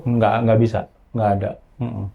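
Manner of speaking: ordinary delivery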